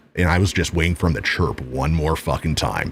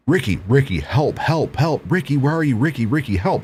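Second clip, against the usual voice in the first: higher-pitched mock cry for help; urgent call for help; 7 semitones higher than usual. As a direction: imitate a woman urgently calling out. Woman calls him for help.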